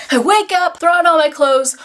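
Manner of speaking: high, loud, panicky recap; frantic retelling. Retold or imitated. Vlogger retelling waking late for a flight, frantic. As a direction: punch it out in a mock rush of panic.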